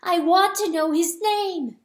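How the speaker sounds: high, loud, insistent cry; near scream. A narrator's reading. Hen insisting on the dead deer's name, near scream. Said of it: "the hen fairly screamed".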